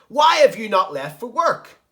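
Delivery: high, loud, slow furious shriek; angry scream. Acted. Teacher voices Ozzy screaming at Carlton, loud and angry. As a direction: scream it like a woken-up octopus. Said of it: "Ozzy was angry about being woken up".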